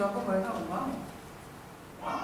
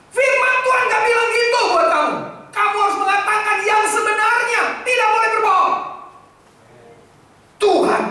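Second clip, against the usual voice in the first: put-on much higher and louder, scolding the child; angry lecturing; 13 semitones higher, 10 dB louder than usual. Father lecturing a lying child, loud angry scolding. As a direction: rage at the kid with God's word. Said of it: "ngomong gini".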